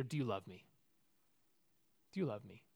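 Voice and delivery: low, soft, falling question; quiet questioning. Acted. Jesus asking Peter after breakfast, low and quiet. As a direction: ask gently, dropped right down in pitch and volume.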